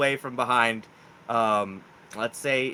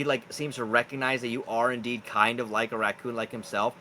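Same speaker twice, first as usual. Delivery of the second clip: usual speaking voice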